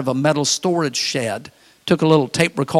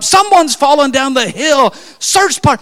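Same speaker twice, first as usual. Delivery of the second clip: higher, louder urgent call; alarmed pastor; 10 semitones higher, 6 dB louder than usual. Retold or imitated. Pastor waking the camp to search the hill: loud, high, urgent.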